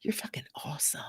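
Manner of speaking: whispered self-affirmation; hushed whisper to your reflection. Shown as a demonstration. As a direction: breathe it to yourself in the mirror. Demo of self-talk in mirror.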